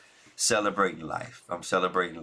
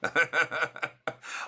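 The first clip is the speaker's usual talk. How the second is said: rapid rippling chuckle, pitched up; real chuckle; 6 semitones higher than usual. Real, not acted. Vlogger amused after telling viewers of love, real chuckle. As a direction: let out a real, easy laugh.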